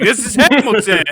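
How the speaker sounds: high, loud, laughing announcement; mock show announcer. Joking about a basement Hamilton show, mock-grand. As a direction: proclaim it grandly, cracking up.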